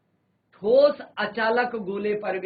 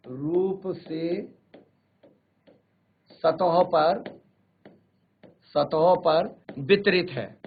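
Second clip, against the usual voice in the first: slow dictation; 3 semitones lower, much slower than usual. Shown as a demonstration. Teacher dictating notes to students.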